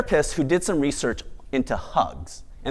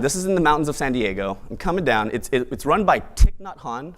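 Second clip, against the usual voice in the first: ordinary talk, nothing marked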